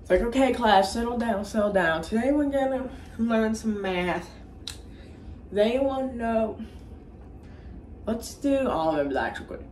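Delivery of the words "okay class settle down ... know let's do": higher teacher voice, slowing down; Mister Garrison. Retold or imitated. Mister Garrison settles his class for math, teacher voice. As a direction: address the class as a retold schoolteacher. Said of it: "So Mister Garrison comes in".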